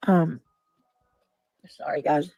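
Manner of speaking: talking round a lozenge; sucking a hard candy. Real, not acted. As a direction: lozenge in the mouth. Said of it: "Yeah, we can tell".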